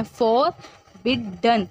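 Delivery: फॉरबिडन drawn out slowly, for, बिड, डन; slow syllable demo. Shown as a demonstration. Teacher breaking the word into parts, slowly. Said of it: "पहले for आया".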